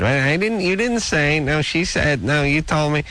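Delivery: high-pitched bickering imitation; whiny mock protest; mock squabbling. Acting out squabble over missed messages.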